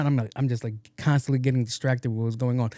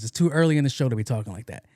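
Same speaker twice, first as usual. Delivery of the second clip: normal talking voice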